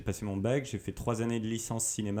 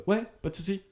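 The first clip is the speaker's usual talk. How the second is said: breezy confidence; 10 semitones higher, faster than usual. Retold or imitated. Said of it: "J'ai fait".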